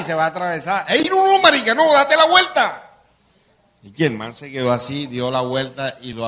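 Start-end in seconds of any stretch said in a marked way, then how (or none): 0.9-2.9 very high, loud panicked plea; high loud urgent cry; frantic warning. Retold or imitated. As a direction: yell the warning in alarm.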